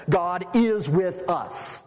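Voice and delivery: "God is with us" drawn out very slowly with weight on "is"; solemn, slow "God is with us"; slow emphatic. Shown as a demonstration. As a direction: lean on "is" and take your time. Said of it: "it means this".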